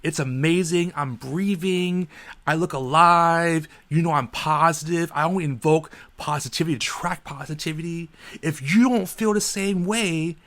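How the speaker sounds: imitating a pushy positivity preacher; mock overly positive voice. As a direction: ham up a relentlessly upbeat cashier. Parodying the relentlessly positive cashier.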